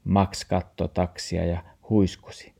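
usual speaking voice

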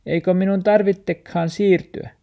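ask, scarcely believing; dog asking, high and hopeful; hopeful dog question. Acted.

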